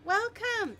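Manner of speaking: high, bright burst of thanks; excited thanks. Real, not acted. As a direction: greet the raider with big excitement. Thanking a raider on stream.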